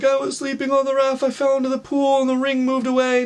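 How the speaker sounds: put-on high wailing child retelling; mock bawling. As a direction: wail the explanation in an imitated cry. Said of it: "I'm bawling my eyes out".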